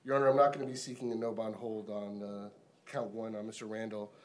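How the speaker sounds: loud, emphatic restatement. Real, not acted. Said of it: "I didn't realize I was muted".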